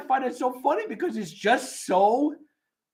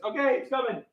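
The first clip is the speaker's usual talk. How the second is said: ordinary talk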